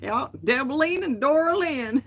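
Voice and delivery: mock-drawled names. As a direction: tease the names Debeline and Dora Lynn like a foreign accent. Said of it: "And I was like".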